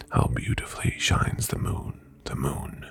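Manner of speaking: eerie whisper. Acted. Ghost lover repeats eerie refrain.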